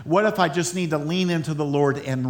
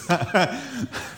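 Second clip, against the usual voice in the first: high breathy burst of laughter; real laugh at the slip; 3 semitones higher, 4 dB quieter than usual. Real, not acted. Laughs at own flub.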